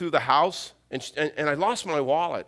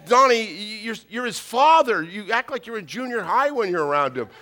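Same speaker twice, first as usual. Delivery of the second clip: higher, louder nagging imitation; mock scolding wife; 7 semitones higher, 5 dB louder than usual. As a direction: act out an exasperated wife's telling-off. Wife scolding husband, acted.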